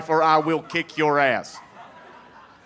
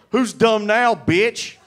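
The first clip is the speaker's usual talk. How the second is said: loud, higher taunting yell; mock taunt; 8 semitones higher, 6 dB louder, slower than usual. Heckler taunting comic in headlock, loud gloating. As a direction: gloat over a guy in a headlock. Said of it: "he's like".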